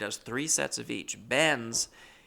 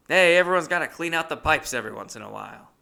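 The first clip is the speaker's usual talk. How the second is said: louder, higher old roommate impression; 5 semitones higher, 8 dB louder, faster than usual. Old roommate offers blunt advice to narrator, imitated loud and high. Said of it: "And he goes".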